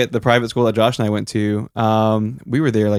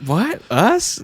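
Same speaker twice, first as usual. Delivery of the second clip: feigned innocence; 7 semitones higher than usual. Retold or imitated. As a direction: act baffled by the accusation. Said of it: "we act like he's a lunatic".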